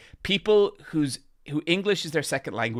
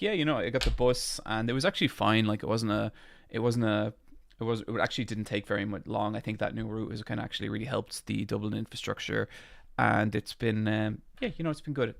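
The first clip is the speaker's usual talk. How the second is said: quick, softer, offhand chat; fast, quieter everyday talk; casual conversational voice; 2 semitones lower, 6 dB quieter than usual. Shown as a demonstration. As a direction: talk like you're across a table from a friend. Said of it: "That's, like, my conversational voice".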